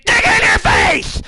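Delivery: high, loud, slow shouted catchphrase. Pretend. Dick Dragons yells his show's catchphrase at listeners, high and slow. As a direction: bellow the show's tagline.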